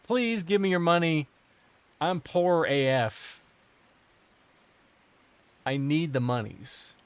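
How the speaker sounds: drawn-out, hammed-up plea for cash; mock begging. Streamer pretending to beg players for money, mock pleading. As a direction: beg like a pretend pauper, stretching the words. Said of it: "just begging people".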